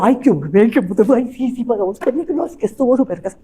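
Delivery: garbled rushed mumble; mumbled fast talk. Shown as a demonstration. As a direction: mumble so nobody understands. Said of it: "no entendí nada".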